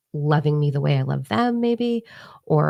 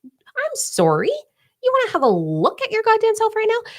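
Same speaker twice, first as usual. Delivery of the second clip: much higher, incredulous indignant voice; mock indignant rant. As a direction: play it as an outraged sassy comeback. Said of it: "and then I'm like".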